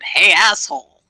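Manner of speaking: loud, indignant call-out; yelled taunt. Acted. Fighter yells at the foe as she swings, indignant shout. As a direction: yell it at him to get his attention. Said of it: "she yells out".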